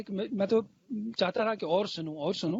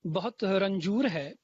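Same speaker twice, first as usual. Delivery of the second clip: stately nazm recitation; 4 dB louder than usual. Acted. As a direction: lean into each line, then pause. Recites a fresh ghazal, repeated lines.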